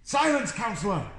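high-pitched pretend telling-off; play-acted shout, starting very high; mock shout. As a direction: play at silencing an unruly councillor. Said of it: "What would they shout at people?".

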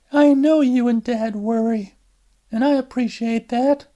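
tired daughter excusing herself to her mother; Alice on the phone. Acted.

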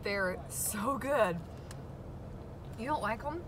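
usual speaking voice

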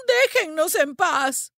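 high, drawn-out yell; slow, emphatic high cry; shouted plea. A narrator's reading. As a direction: drive the birds away with a shout. Woman yells while stoning crows.

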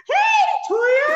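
high, shouted squeal of a greeting; excited mother's greeting. Retold or imitated. Imitating the mother coming by to greet Toya, excited shout. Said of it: "the mother came by and was like".

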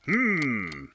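high puzzled hum; musing detective. A narrator's reading. Detective pondering the case, puzzled hum. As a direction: think it over.

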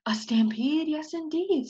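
whispered, hushed; awed whisper. Acted. Jackrabbit whispers back the stampede news. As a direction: breathe it in wonder.

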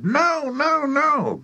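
high-pitched alarmed protest; acted panicked noes; horrified cry. A narrator's reading. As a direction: cry out high and urgently to stop it. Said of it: "the other two cried".

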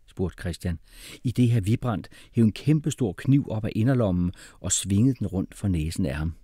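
usual speaking voice

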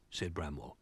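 ordinary delivery

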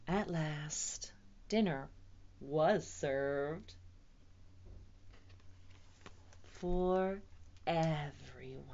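hushed, drawn-out close with pauses; storybook ending. A narrator's reading. Storyteller closes the tale for children, slow and hushed. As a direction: wind down softly, spacing out each phrase.

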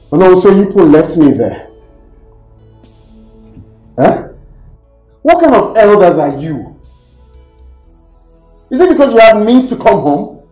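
loud, indignant scolding; angry reproach. Acted. Left behind, rounding on the elders, angry reproach. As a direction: take them to task at full voice. Upbraids elders for leaving them.

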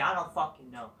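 ordinary talk, nothing marked

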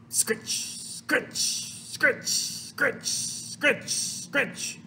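soft, hushy, unvoiced scritches; scrubbing noises. A narrator's reading. Reader imitates mother washing her mouth, scrubbing noises. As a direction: rasp out rhythmic whispered scrubs.

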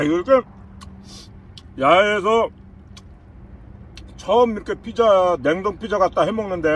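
speaking between bites; chewing while talking. Real, not acted. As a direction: mouth partly full. Seen 2: holds pizza, bit it just before, mouth working while speaking.

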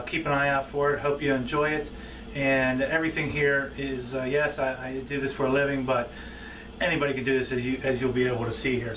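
normal talking voice

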